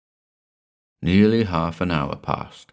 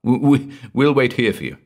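high, stammered excuse; nervous, hesitant backing out; faintly laughing nerves; 7 semitones higher, 4 dB louder than usual. A narrator's reading. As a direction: stammer it nervously, louder and higher. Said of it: "with a faint laugh".

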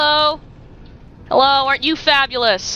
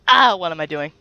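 usual speaking voice

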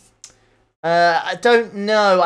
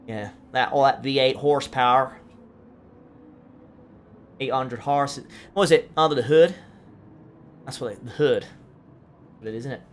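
put-on American car-guy accent, a bit lower; 3 semitones lower than usual. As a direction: play at sounding American. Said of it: "I've apologised for my American accent".

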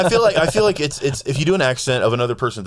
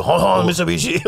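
higher caricature Japanese accent; mock Japanese accent; 6 semitones higher than usual. Shown as a demonstration. Showing which accent is worse, mock Japanese. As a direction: demonstrate a cartoon Japanese accent. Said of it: "not as bad as if you do".

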